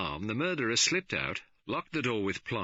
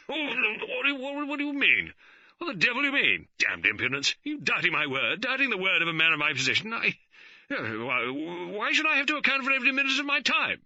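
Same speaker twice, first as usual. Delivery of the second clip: high, loud, sputtering indignation, words tripping; spluttering outrage; 5 dB louder than usual. A narrator's reading. George spluttering at the superintendent, high and loud. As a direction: choke out furious protests. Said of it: "He began to splutter".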